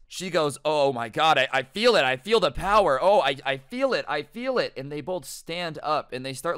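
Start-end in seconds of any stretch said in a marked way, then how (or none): 0.6-4.7 loud, gushing play-along amazement; hammed-up rapture, loudly insistent; mock awestruck excitement. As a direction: act overcome by a mysterious power. Acting mom playing along.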